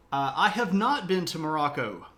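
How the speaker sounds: usual speaking voice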